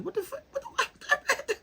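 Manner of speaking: very high, squealing disbelief with laughter; mock mad outburst. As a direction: act mad, voice shooting into falsetto. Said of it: "I'd be mad".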